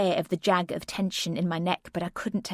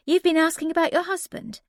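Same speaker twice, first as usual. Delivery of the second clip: higher, lighter nurse voice; a brisk nurse at the door, higher; 11 semitones higher, 4 dB louder than usual. A narrator's reading. Nurse tells patient of husband, higher and lighter.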